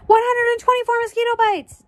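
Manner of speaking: high, loud triumphant call; high-pitched excited shout. Acted. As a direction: call the answer out across the class. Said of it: "Dana called".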